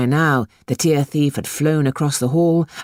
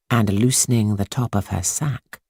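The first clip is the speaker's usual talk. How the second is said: ordinary delivery, nothing marked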